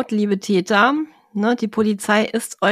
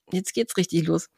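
normal talking voice

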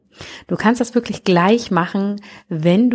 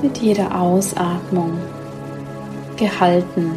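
sleep meditation. Acted. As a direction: guide them gently into sleep.

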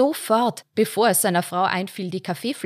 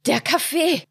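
sharp high yelp of pain; cry of pain; 8 semitones higher, 3 dB louder than usual. A narrator's reading. Father yelps at scalding coffee, pained cry. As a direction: cry out as hot coffee burns. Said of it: "schrie er aus".

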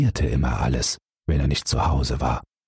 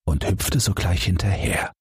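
plain reading voice

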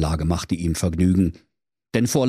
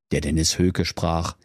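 ordinary delivery, nothing marked

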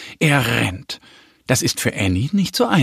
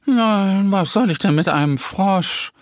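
higher, dismissive horse voice; bored mare; 9 semitones higher than usual. A narrator's reading. Mare answers the bear's question about a frog, bored. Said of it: "Die Stute schüttelt gelangweilt den Kopf".

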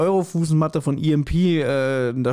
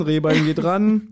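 usual speaking voice

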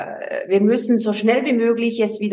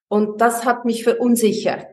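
usual speaking voice

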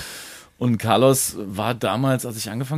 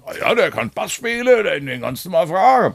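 imitating the father; 6 semitones higher than usual. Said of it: "habe ich deinen Vater gefragt".

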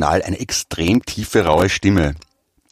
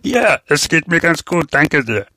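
higher, slow put-on voice; disguised dubbing voice; 7 semitones higher, slower than usual. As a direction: disguise your voice like a dubber. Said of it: "so ein Dialog zwischen Tom Hanks und Bill Murray".

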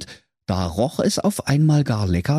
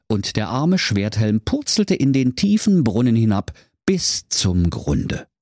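ordinary delivery, nothing marked

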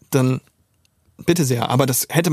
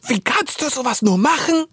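much higher, put-on accusing girl's voice; angry best friend; 10 semitones higher than usual. Silvi, the best friend, accuses him on the phone: high, reproachful girl's voice. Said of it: "Und Silvi sagt".

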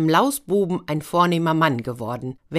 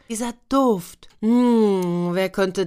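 savoring the smell; 4 semitones higher, slower than usual. Acted. Boy savoring smell of cakes.

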